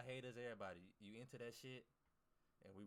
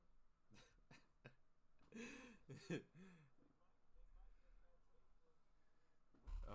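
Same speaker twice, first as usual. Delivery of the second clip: long, high, breathy cackle; wheezing high laugh; big laugh; 13 semitones higher, 5 dB quieter than usual. Real, not acted. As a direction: burst out laughing at them. Laughing at copy traders.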